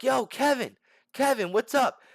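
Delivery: high, eager fan hail; calling out greeting. Retold or imitated. Fan calls to Kevin walking in, excited greeting. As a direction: call over to a fighter you recognise.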